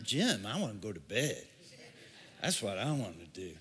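low, soft, quick groggy mumble; sleepy refusal. Retold or imitated. Tired person turning down the gym, groggy low mumble. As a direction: brush it off like you're half asleep.

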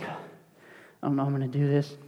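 imitating someone nodding off, quiet and low; drowsy, fading imitation; dozing off. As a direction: mumble it softly as if half asleep. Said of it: "dozing, head's nodding".

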